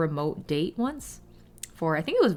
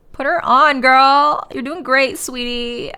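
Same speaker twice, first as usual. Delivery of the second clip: put-on loud, higher Southern accent; 5 semitones higher, 10 dB louder, slower than usual. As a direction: cheer her on in a Southern accent. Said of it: "Why am I suddenly Southern?".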